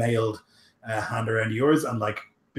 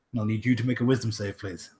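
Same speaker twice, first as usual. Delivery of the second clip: ordinary delivery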